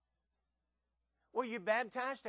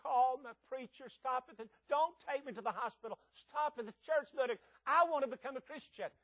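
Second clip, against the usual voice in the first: higher, louder pleading of a dying man; desperate begging; 6 semitones higher, 4 dB louder than usual. Retold or imitated. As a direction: beg the driver to stop, mildly imitated. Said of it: "he began to beg".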